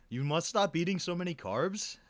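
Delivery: high imitation of a French director; French accent. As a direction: scold in a put-on French accent. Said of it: "like, in a French accent".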